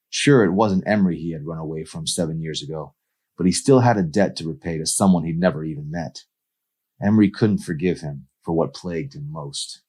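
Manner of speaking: ordinary delivery, nothing marked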